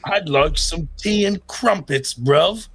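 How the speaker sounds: slow, high posh-ish British put-on; mock British accent. Mocking the British to his co-host in a put-on accent. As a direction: lay the British accent on thick.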